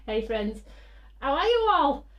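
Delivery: high, loud, bright hello; cheery greeting. Real, not acted. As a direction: greet them with a big lift.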